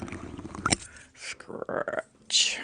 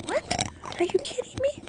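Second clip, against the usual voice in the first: mock disbelief, much higher pitch; incredulous what. Retelling her own reaction to a stream viewer, in high mock disbelief. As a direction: squeal it out like she can't believe it. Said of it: "I'm going".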